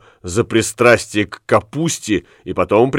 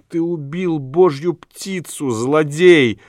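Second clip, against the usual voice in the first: reproachful cry; 7 semitones higher, slower than usual. A narrator's reading. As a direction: cry out high in restrained indignation. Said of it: "крикнула она". (негромкий высокий укоризненный вскрик)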